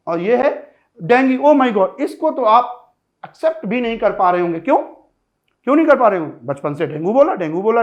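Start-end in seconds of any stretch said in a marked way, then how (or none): none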